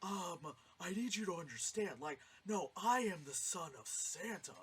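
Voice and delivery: imitated hammy leading man; exaggerated acting voice. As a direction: mimic overearnest bad movie acting. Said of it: "That's how the guy was talking".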